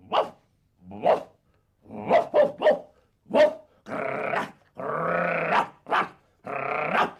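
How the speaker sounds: loud barking and growling; dog bark. Retold or imitated. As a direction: bark and snarl like a big dog.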